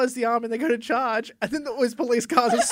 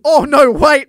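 much louder, higher panicked blurt; mock panic; 5 semitones higher, 17 dB louder, slower than usual. Knight realising he gave the wrong answer, mock panic. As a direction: yelp it out as a doomed knight.

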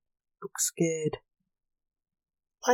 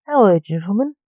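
louder, smooth charming hello; charming greeting; 2 semitones higher, 9 dB louder than usual. A narrator's reading. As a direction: win them over with a louder, sweet greeting. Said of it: "to them charmingly".

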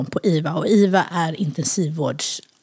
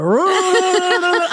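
long, high-pitched mock fart sound; imitated fart noise; 11 semitones higher than usual. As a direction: draw out a comic fart imitation. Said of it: "Alltså, det var så här". (långt härmat pruttljud)

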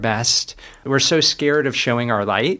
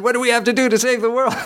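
much higher, quicker, mock-earnest question; the journalist asking; 12 semitones higher, faster than usual. Paris journalist questioning the author, high mock-earnest imitation. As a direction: ham up the journalist's big question, pitched way up. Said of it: "he said".

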